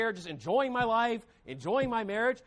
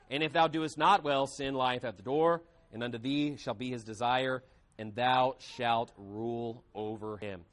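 measured, lower scripture reading, slowing at the end; 7 semitones lower than usual. Acted. Preacher reading Genesis to the church, measured and solemn. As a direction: read the verses with weight, slower at the close.

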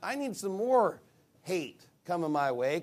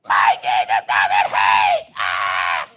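loud, distorted drawn-out scream; hammed-up furious yell, ending in "ah"; mock screaming; 9 semitones lower, 12 dB louder, much slower than usual. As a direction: imitate a raving heckler at full volume. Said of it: "You're screaming at me".

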